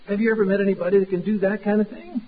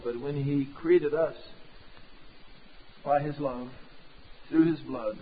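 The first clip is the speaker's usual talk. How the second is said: hushed, earnest prayer voice, trailing soft at line ends; closing prayer; 7 dB quieter than usual. Acted. Pastor praying over his congregation, hushed and earnest. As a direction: pray it gently over the congregation.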